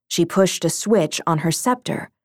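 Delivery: ordinary delivery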